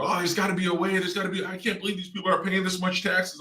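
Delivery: high, exasperated imitation of his wife; nagging wife. Husband mimics wife nagging about taxes, high and exasperated. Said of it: "my wife was always, you know, crawling down my throat".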